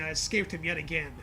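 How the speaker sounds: ordinary delivery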